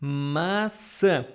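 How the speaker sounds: ordinary talk, nothing marked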